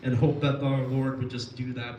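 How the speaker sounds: usual speaking voice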